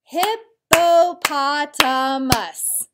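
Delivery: very slow and loud, each syllable of "Hippopotamus" hit separately; clapped syllables. Shown as a demonstration. Teacher claps the long word with her students, slow and loud. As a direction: clap out "Hippopotamus" in five loud beats. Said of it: "Let's clap it out".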